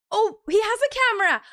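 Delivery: high, loud discovery after a gasp; gasp, then exclaim high; excited surprise. Real, not acted. Streamer suddenly seeing the guest has a camera, surprised.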